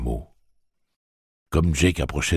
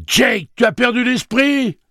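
high, loud cry of disbelief; alarmed shout; 9 dB louder than usual. A narrator's reading. Friend telling Jake he's lost his mind, alarmed shout. Eddie protests at boy's decision.

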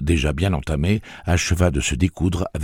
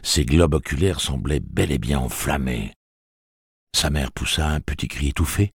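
ordinary delivery, nothing marked

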